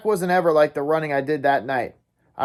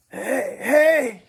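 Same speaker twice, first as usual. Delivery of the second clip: ordinary delivery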